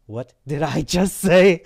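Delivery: slow, loud, stunned question; building, incredulous self-questioning; shocked disbelief. Retold or imitated. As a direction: stretch each word in astonishment. Shock at his own words.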